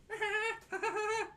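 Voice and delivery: a high, squeaky burst of laughter. Real, not acted. Delighted squeal of a laugh at his dog's antics.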